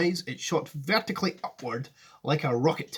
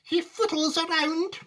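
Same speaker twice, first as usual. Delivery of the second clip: put-on high, slow old woman's complaint; Grandma Georgina; 13 semitones higher than usual. Grandma complaining about Wonka, high and drawn-out. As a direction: grumble it slowly, pitched high. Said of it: "said Grandma Georgina".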